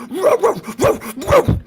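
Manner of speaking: high, loud playful barking; barking dog. A narrator's reading. The dog barking happily at the narrator, loud high barks. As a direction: bark like an eager big dog.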